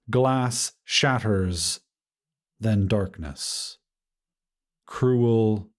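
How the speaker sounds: ordinary delivery, nothing marked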